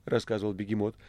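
ordinary delivery, nothing marked

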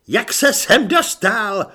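loud, high, drawn-out yelp; startled shriek. A narrator's reading. Corporal finding Igor suddenly behind him, shrieking. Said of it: "zaječel".

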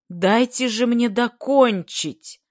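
high, loud, drawn-out plea; pleading shout. A narrator's reading. Gremushin begs to finish speaking, pleading shout. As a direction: implore them over the din. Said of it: "взмолился Гремушин".

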